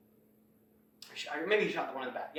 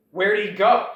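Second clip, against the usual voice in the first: alarmed call; 5 semitones higher, 10 dB louder than usual. Retold or imitated. Villain notices missing hero.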